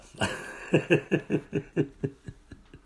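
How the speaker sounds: a high, real burst of laughter; laughing. Real, not acted. Laughs at himself.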